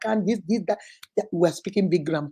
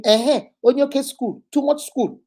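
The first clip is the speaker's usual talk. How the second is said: sneering sing-song jab about school; mock him mocking her education; higher, taunting mimicry; 4 semitones higher, 4 dB louder than usual.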